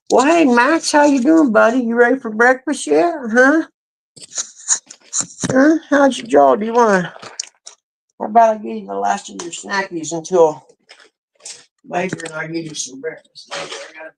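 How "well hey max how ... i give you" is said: high pet talk. Real, not acted. Greeting his dog Max at breakfast, high doggy baby talk. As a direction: coo at him like a puppy, pitched way up.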